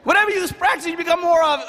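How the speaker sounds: high-pitched mock mother's refrain; high, insistent motherly mantra; strict mom. Mimics mom's repeated rule to son.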